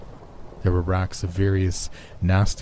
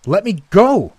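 high, loud desperate shout; struggling captive's loud cry; screaming; 13 semitones higher, 7 dB louder than usual. A narrator's reading. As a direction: scream to be released, pitch leaping up. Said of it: "I screamed".

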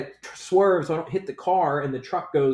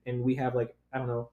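normal talking voice

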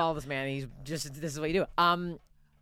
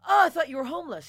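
higher and louder, clueless; loud blurted gaffe; 10 semitones higher, 7 dB louder than usual. Retold or imitated. Comic blurting to Bill Burr, loud and clueless. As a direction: blurt it out without thinking.

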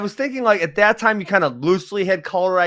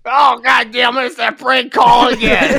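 high, loud, drawn-out mock outburst; mock exasperated shout; 8 semitones higher, 5 dB louder, slower than usual. Reliving the coach's reaction to prank calls, hammed-up angry yell. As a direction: holler it as the exasperated prank victim, slowly, laughing.

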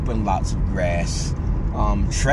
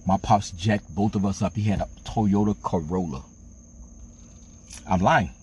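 usual speaking voice

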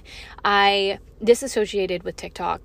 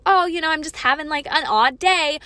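higher-pitched imitation of a minor grumble; mock casual complaint; 6 semitones higher than usual. Podcaster dismissing the milder meaning of feeling off, mock casual complaint. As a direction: play down a bad day, mockingly light. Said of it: "I don't mean, like".

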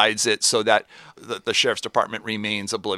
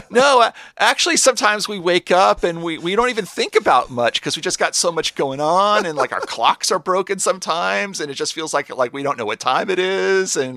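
high, loud rambling earnest answer; Lucy's voice; 8 semitones higher, 6 dB louder than usual. Retold or imitated. Lucy answering Chad's taunt, high and eager. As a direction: gush it out high and eager like a sweet ditzy clerk.

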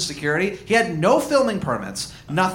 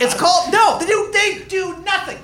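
higher and louder, laughing through it; excited laughing; 9 semitones higher, 3 dB louder than usual. Real, not acted. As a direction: gush about it, cracking up. Gushing, laughing about movie.

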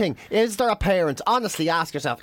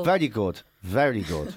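low, unconvinced mock praise; feigned appreciation; 7 semitones lower than usual. Comic humours a joke-teller, feigned appreciation. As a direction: politely humour a bad joke, voice dropping. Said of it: "you kind of feign a laugh".

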